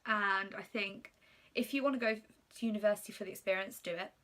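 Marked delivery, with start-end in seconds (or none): none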